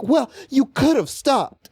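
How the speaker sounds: high, slow mock reproach; indignant older lady. Startled lady telling the walker off, mock indignant. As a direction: draw it out high, like an affronted lady. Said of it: "she was, like, indignant".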